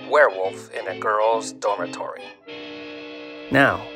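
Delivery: usual speaking voice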